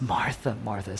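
low, soft and subdued; moved, grieving. Acted. Jesus looking at Martha, moved, low and soft. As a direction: say the name softly, letting it sink. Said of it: "So really, he's crying".

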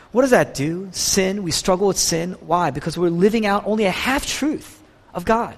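normal talking voice

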